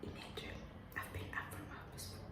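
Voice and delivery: hushed fast whisper; breathy whisper, keep it low; library whisper. Real, not acted. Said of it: "I'm whispering because other people are still resting".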